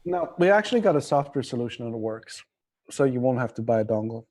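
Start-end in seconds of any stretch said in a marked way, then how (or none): none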